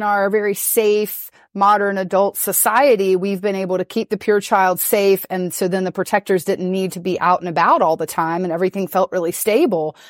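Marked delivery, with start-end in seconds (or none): none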